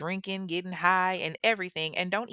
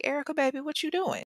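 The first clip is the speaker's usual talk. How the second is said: imitating a worried mom; 9 semitones higher than usual. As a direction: voice the mom, pitched up, gently puzzled. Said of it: "And she's like".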